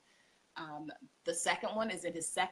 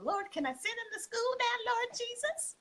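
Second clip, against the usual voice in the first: much higher, imitating a desperate plea to the Lord; pleading prayer. Homeschooling mom retelling her prayer to God, high pleading. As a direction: cry up to heaven in a high mock plea.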